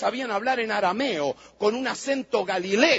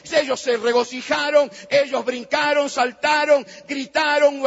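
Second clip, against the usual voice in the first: fiery preaching; 3 semitones higher than usual. Acted. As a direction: build the congregation up with fervor. Preaching at full force.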